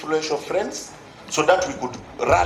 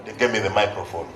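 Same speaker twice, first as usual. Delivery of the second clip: ordinary talk, nothing marked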